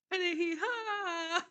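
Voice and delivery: high, drawn-out words collapsing into laughs; can't finish the sentence for laughing; breaking into laughter. Real, not acted. Vlogger recapping a trolling entrance to viewers, cracking up. Cracking up retelling wrestler's prank.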